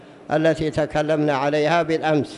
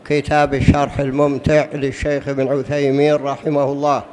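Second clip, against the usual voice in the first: ordinary talk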